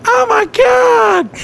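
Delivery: slow, high shriek of shock; mock terrified scream. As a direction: scream like you found frogs in the tub. Roommate finding bullfrogs in tub.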